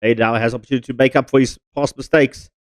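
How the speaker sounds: ordinary delivery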